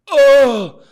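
sudden high loud gasp of an oh; startled cry. Retold or imitated. Startled when the spray hits Lauren, loud high shocked oh. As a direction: jolt as the blood spray hits. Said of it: "and I just said".

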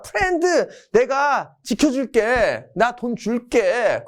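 high, slow, drawn-out reassurance; mock-generous high voice; hammed-up friendly promise. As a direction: play the big-hearted pal, stretching each phrase. 미국이 친구에게 약속함.

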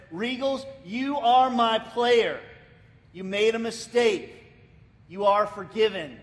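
loud, slow, firm reassurance; coach forgiving. Retold or imitated. Preacher voicing the coach forgiving his shamed player. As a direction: plant each word like a coach who believes in him.

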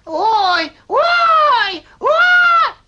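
ouches building up to a loud high shout; groans rising to a howl. Acted. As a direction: crank the moaning up to a yell.

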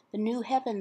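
ordinary delivery

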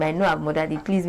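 ordinary talk

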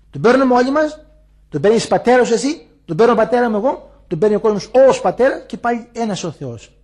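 high, loud rising proclamation; preaching at full force. Acted. Elder proclaims to the faithful that God is one, loud and high. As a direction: ring it out to the whole congregation.

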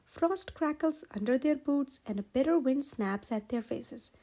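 ordinary delivery

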